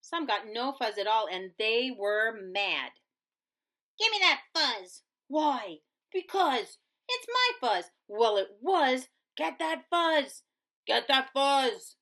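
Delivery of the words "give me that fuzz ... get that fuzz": snatch and bicker; angry squabbling. Acted. Prairie dogs fighting over fuzz, loud and high.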